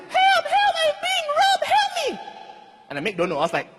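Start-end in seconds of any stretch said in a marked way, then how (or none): 0.1-2.2 very high, shrill falsetto cry for help; high-pitched, hammed-up screaming for help; screaming lady. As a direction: shriek it like a robbed woman. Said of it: "the poor lady is like".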